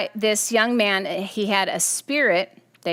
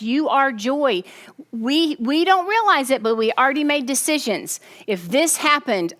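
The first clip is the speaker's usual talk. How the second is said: normal talking voice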